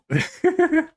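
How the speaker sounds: squeaky nervous laugh; real high giggle of despair; high panicked laugh. Real, not acted. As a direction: laugh in disbelief at the mistake. After closing game.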